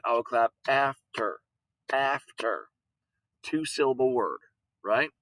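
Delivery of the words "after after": after clapped out in two beats, AF-TER; clapped syllables. Shown as a demonstration. Teacher to class, clapping out after in two beats. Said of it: "If I was clapping it".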